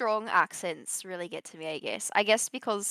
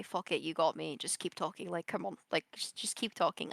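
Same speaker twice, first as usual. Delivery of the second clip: softer, lower aside, swept along; quiet giving in; 3 semitones lower, 9 dB quieter than usual. Acted. Surrendering to Aussie cops for their accents, quietly coaxing. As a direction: let it drop soft and low, coaxing them on.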